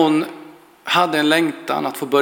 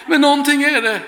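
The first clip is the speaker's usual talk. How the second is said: laughing; 9 semitones higher than usual. Retold or imitated. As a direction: laugh it out, delighted. Old woman startled mid-prayer.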